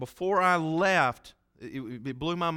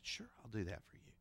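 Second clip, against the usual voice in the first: soft whispered promise; whispered reply; 4 semitones lower, 17 dB quieter than usual. Retold or imitated. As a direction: whisper it tenderly at the bedside. Gentle answer to dying woman.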